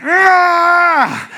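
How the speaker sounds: long, high, loud effort scream; straining lift yell. Retold or imitated. Lifter heaving up a heavy bar, straining yell.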